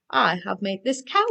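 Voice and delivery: ordinary delivery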